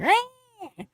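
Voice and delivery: high piercing zombie screech; pterodactyl shriek. Retold or imitated. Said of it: "they screech like a pterodactyl".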